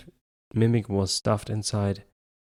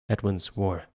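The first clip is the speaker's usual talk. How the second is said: usual speaking voice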